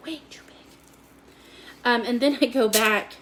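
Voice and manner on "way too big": whispered aside. Real, not acted. Whispered repeat of judgement.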